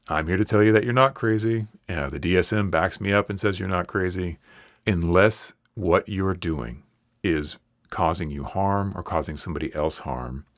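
ordinary delivery

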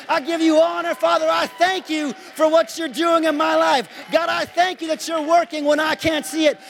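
loud, high preaching voice with every line punched; preacher lifting praise to God at full force. Acted. Preacher praising God before the congregation, loud and high.

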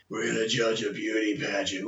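low, soft put-on voice; mock tough-guy reply. Inventing banter for Clint Eastwood, low deadpan voice. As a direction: drop low and toss off the quip.

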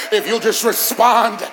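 loud, high preacher's crescendo, punching each phrase; full-force preaching. Real, not acted. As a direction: build to a drawn-out shout.

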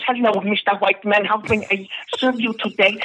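very high, dainty servant voice; geisha voice. Acted. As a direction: simper it up high. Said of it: "and she says".

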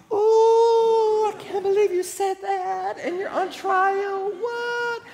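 very high falsetto mock shock; long "Ooh" then squeaky disbelief; scandalized crowd. Onlookers reacting to Paul's insult at his trial, falsetto shock. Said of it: "And everybody's all".

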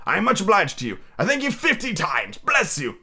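high, effusive thanks; grateful Scrooge. Acted. Scrooge to the old gentleman, effusively grateful. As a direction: gush thanks in character.

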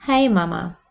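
ordinary talk, nothing marked

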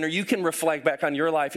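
ordinary talk, nothing marked

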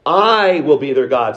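"I" held long, above the following words; stress on I. Shown as a demonstration. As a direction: lean hard on "I" at the start. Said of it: "look at the inflection here".

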